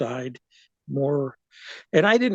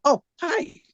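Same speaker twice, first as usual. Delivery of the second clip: surprised greeting; 14 semitones higher than usual. Retold or imitated. Meeting grandmother for first time.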